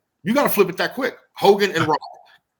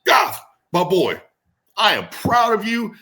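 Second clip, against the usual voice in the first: a wrestler voicing Larry's backstage praise, higher and proud; imitating the promoter; 9 semitones higher, 4 dB louder than usual. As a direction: voice the old promoter handing out compliments. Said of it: "And Larry comes around the corner".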